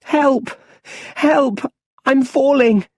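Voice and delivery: high, loud cries for help; panicked shouting. A narrator's reading. Little Bruce falling into the well, high, loud, terrified. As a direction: yell in terror as he slips. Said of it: "He's shouting".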